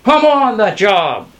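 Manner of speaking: loud, high eager shout; high rallying cry. A narrator's reading. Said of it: "shouted Sasha".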